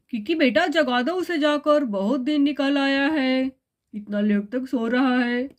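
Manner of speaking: low-toned father voice; a father chatting with his daughter; father character. Acted. Father asks daughter to wake brother. (पिता की आवाज़)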